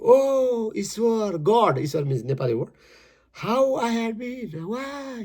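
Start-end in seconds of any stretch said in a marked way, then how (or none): 0.0-1.8 high plaintive call to God; acted lament, pitched up; mock crying out. Poem's man cries out to God, mildly plaintive. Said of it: "He will cry at the time". 3.3-5.2 high, drawn-out lament; acted crying out. Poem's man questions his fate, high acted lament. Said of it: "He will cry at the time".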